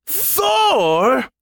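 very high, disbelieving outburst; drawn-out rising question in outrage; indignant roar. A narrator's reading.

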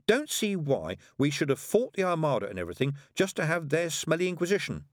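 a hint of an indignant lad; lightly voiced grumbling boy. A narrator's reading. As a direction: complain like a put-out schoolboy.

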